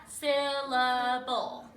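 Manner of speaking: "Syllable" very slow and loud, split in three. Shown as a demonstration. Teacher showing students how to count syllables, "Syllable" in three drawn-out beats. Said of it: "put your hand under your".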